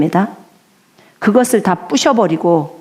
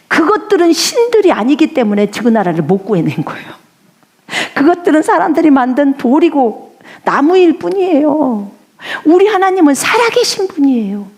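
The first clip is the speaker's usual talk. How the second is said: high, rising impassioned plea; fervent prayer; 8 semitones higher than usual. Acted. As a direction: plead with growing fervour to God. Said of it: "이렇게 표현합니다". (점점 높아지는 간절한 기도)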